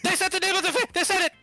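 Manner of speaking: high, loud excited squeal; squealing excitement. Acted. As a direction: gasp and squeal like a fangirl. Mock gasp excitement at title.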